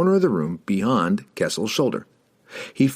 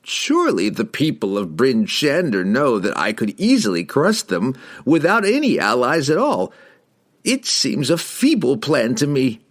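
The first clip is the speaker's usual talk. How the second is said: higher, haughty dismissal; scornful wizard; 6 semitones higher, 3 dB louder than usual. A narrator's reading. Wizard doubts the halfling's story, haughty and higher. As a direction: scoff at a feeble scheme. Pleased wizard scoffs at the plan.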